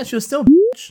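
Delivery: sudden high loud exclaim; loud outburst. Real, not acted. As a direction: blurt it out loud.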